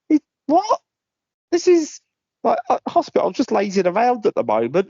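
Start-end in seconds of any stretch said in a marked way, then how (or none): 0.1-3.2 high, incredulous "It, what?"; can't believe the rules, voice shooting up; disbelief. Real, not acted. Hearing the rehab rules, high incredulous disbelief.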